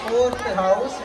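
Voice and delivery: normal talking voice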